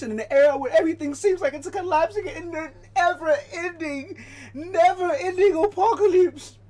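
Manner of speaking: rising from plain to a loud, high wail; mock melodramatic build. Streamer mocking a chat question, building to a wail. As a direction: spiral into a hammed-up doomsday lament.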